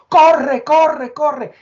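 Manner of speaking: high, loud shouted order; retold shout to run. Someone screaming at him to flee the collapse, loud high shout. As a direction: yell the warning as the tower falls. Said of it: "de momento oigo".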